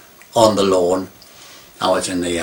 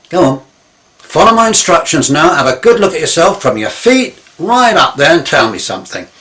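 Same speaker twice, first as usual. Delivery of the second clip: firm instructions; 5 semitones higher, 8 dB louder than usual. Retold or imitated. Instructing friend on the lawn.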